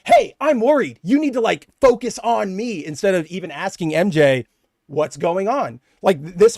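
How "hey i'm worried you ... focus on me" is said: higher, louder, needy mock of the boyfriend; self-centred demand. Boyfriend turning her news into his worry, mock needy. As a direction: make it all about yourself, a bit pushy. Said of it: "He's going".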